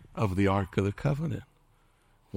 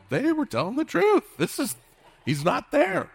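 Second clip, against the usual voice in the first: very high, louder amazed outburst; astonished; 4 dB louder than usual. Retold or imitated. Pastor acts disciples finding the tomb empty, astonished. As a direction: exclaim it in high-pitched disbelief.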